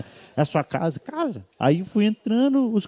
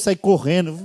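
usual speaking voice

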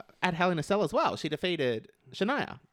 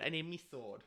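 Drew McIntyre; 14 dB quieter than usual. Acted. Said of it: "if I was Drew".

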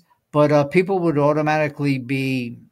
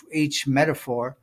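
ordinary talk

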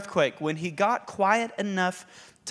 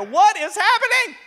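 high, loud panicked cry; mock panic; 13 semitones higher, 7 dB louder than usual. Voicing Elijah's panic amid fire.

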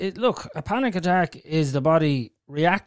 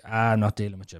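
dismissive brain voice; 5 semitones lower, 7 dB quieter, faster than usual. Retold or imitated. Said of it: "And then my brain is like".

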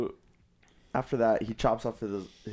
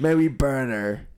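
higher, loud mock shout; mob demand; 4 semitones higher, 6 dB louder than usual. Villagers demanding of Bedivere, hammed-up loud plea. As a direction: holler it like an eager crowd. Crowd asking to burn the witch.

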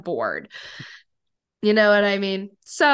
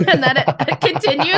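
laughing; 5 semitones higher, 5 dB louder, faster than usual. Real, not acted. Laughing at own joke.

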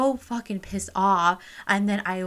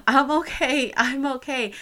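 insisting through tears; 6 semitones higher, 4 dB louder than usual. Retold or imitated. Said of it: "while I'm crying, I am still literally saying".

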